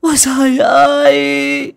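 keen to the heavens in acted grief; shrieking lament. A narrator's reading. Woman kneeling by the body, shrieking and lamenting. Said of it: "Mộ Vũ quỳ xuống lại chu chéo".

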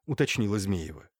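plain reading voice

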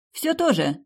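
a high, bright shout back; shouting back to the guard. A narrator's reading. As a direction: yell it through the door. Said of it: "крикнула я".

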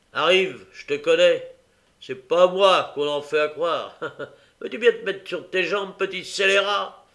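loud rough scolding; bully the boy to his feet; a policeman scolding the boy; gruff scolding. Acted.

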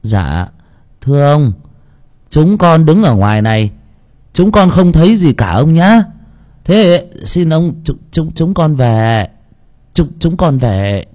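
put-on stammering, slow, rising nervous child; high frightened boy stuttering; timid pleading. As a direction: beg the master to let you go. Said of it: "nó nhẹ giọng".

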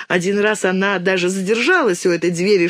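ordinary delivery

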